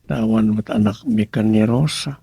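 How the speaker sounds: normal talking voice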